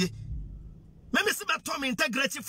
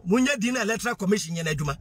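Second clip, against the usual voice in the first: usual speaking voice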